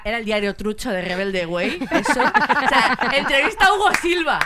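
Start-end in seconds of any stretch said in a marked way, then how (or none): 1.9-4.4 excited announcement. Real, not acted. Excited retelling of fake interview.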